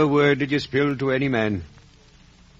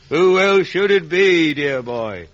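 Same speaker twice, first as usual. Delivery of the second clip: laughing, then higher and louder; proud laughing reveal; 5 semitones higher, 4 dB louder than usual. Acted. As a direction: laugh and crow it out in pride. Convict proudly reveals benefactor.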